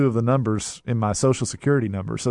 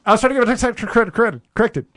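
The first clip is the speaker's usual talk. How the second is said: higher, louder, fast frustrated caller; 9 semitones higher, 6 dB louder, faster than usual. Retold or imitated. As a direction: blurt it out before they transfer you.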